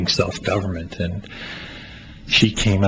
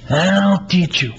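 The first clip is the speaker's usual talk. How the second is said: high, loud and slow, proud offer; 10 semitones higher, 3 dB louder, slower than usual. Acted. Playwright voices the friend offering to teach Melvin, high and slow. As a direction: promise to teach him, beaming.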